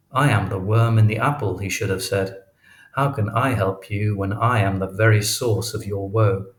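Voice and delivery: plain reading voice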